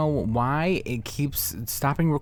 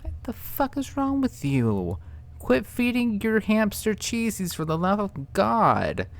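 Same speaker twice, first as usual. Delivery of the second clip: exasperated outrage; 8 semitones higher than usual. Real, not acted. Scolding over hamster.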